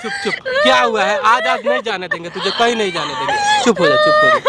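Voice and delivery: high pleading hush, over and over; soothing hush. Acted. Man hushing the crying woman to calm her, high and pleading. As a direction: coax her to stop crying with repeated hushes.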